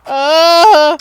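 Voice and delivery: short loud yelp; screaming while falling. Real, not acted. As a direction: cry out as you drop. Falling off roof in game.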